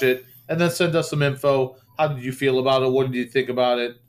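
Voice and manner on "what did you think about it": ordinary talk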